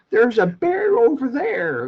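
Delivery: high, slow, mock American accent. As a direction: echo the American driver's drawl back. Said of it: "that's pretty much what it was".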